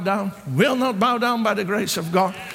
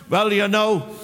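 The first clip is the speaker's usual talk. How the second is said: ordinary delivery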